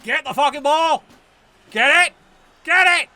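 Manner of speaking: loud yelling at the screen; urgent shouts. Real, not acted. Gamer shouting at his team to grab the ball.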